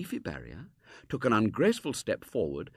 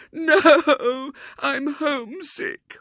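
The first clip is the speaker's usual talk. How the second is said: high, slow, sobbing wail; wailing little girl; 12 dB louder, much slower than usual. A narrator's reading. Homesick girl confessing to William, drawn-out wail. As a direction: draw it out through loud mock tears. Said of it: "wailed the little girl".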